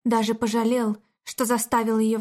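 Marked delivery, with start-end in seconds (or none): none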